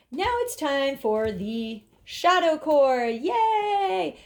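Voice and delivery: drawn-out announcement rising to a high "Yay"; playful fanfare. Pretend. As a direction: announce it slowly like a treat, then cheer. Playfully announces the next step.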